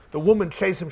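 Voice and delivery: ordinary delivery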